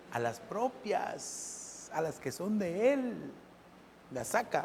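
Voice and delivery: soft slow answer. Real, not acted. Preacher to congregation, soft slow answer. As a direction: let the answer sink in quietly. Answers his own question softly.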